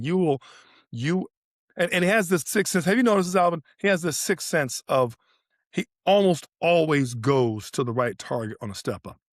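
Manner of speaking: ordinary talk, nothing marked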